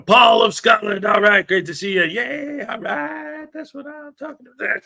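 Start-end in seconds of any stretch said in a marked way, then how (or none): 0.0-1.0 a slow, louder hail of the name; called out. Real, not acted. Streamer hailing a viewer who joined the chat loudly. 2.0-4.8 cartoon-high, softer yay and cheer; squeaky high cheer. Streamer cheering a viewer's arrival in a squeaky voice.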